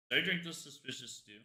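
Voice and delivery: ordinary talk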